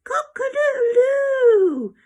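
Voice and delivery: loud, high, slow crowing; full-throated rooster call. Shown as a demonstration. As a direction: crow it out like a rooster at dawn. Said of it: "That one makes a sound".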